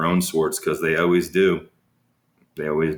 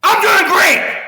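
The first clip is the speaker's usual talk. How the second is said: much higher, much louder, slow furious yell; upset shout; 8 dB louder, slower than usual. Shown as a demonstration. What the surgeon heard telepathically, an upset shout. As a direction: blast the hidden anger out behind the polite words. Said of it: "Now, what he heard was".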